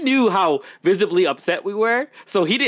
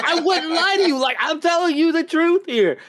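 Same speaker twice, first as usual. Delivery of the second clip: higher, insistent pleading; pleading retold; 9 semitones higher than usual. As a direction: reassure him, pitched up. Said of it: "and I was like".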